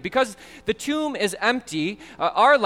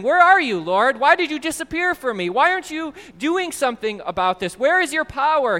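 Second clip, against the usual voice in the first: louder, higher pleading cry; desperate plea, voice raised and lifted; crying out to God; 6 semitones higher, 5 dB louder than usual. Acted. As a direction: call out to God as if abandoned. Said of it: "As we cry out to him".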